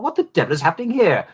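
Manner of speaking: quick, clipped mid-Atlantic accent, lightly done. Shown as a demonstration. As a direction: play it as a prim mid-Atlantic academic. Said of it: "between Niles Crane and Sheldon Cooper".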